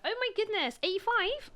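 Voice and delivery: high-pitched, surprised Bristolian imitation; Bristolian accent. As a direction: put on an amateurish Bristol accent, astonished. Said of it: "well, she was Bristolian".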